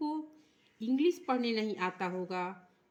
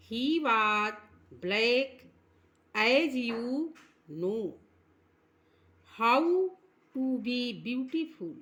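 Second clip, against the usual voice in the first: slow, drawn-out recitation; slow poem reading; much slower than usual. Shown as a demonstration. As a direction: read each word slowly for the class. (धीमा कविता पाठ)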